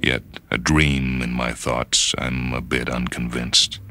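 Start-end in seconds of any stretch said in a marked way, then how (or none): none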